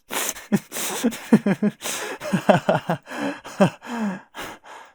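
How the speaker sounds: high, loud wheezing laugh fit; long helpless laugh. Real, not acted. [name] cracking up at comedy-gold selfies, long helpless laugh. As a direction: laugh until it hurts. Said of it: "why can't I stop laughing".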